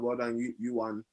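ordinary talk, nothing marked

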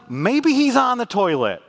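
high, loud, slow mocking jab; sarcasm. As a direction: drip sarcasm like Elijah. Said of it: "That's sarcasm".

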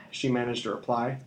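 usual speaking voice

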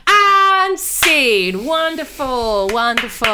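high, loud mock luvvie praise, slow on the first wonderful; hammed-up director. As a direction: play a gushing theatre director.

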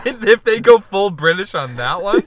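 words breaking with laughter; laughing. Real, not acted. Cracking up at the British Fukushima joke, laughing.

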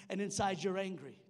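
usual speaking voice

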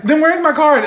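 high, loud demanding question; angry demand. Retold or imitated. Retold bar argument.

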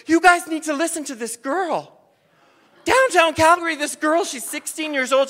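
loud, high call to the whole bus; loud announcement. Retold or imitated. Bus driver addressing passengers.

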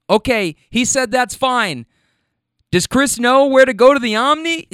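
acted caller pressing for confirmation; man on phone. Friend relaying back into the phone.

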